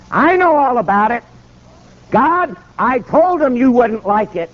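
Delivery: high-pitched calling out to God; imitated loud declaration. Preacher testifying to God before governor, imitated proclaiming. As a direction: testify aloud so bystanders gather round. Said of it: "he's talking so loud".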